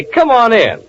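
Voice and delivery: usual speaking voice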